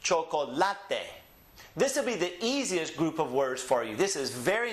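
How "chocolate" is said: slow Spanish chocolate, cho-ko-LA-te; Spanish next-to-last stress. Shown as a demonstration. Teacher shows it is Spanish, chocolate slowly with stress on LA. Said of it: "it's the next to last syllable that's emphasized".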